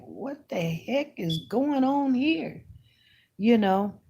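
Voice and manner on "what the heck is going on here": high, soft, slow mock puzzlement; hushed, drawn-out disbelief, replayed; retold bewilderment. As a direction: act baffled under your breath, stretching it out. Retells bewilderment at a stranger's outburst.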